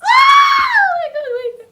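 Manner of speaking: very high, loud laughing shriek; shrieking laughter. Real, not acted. Telling her escape to viewers, laughing shriek.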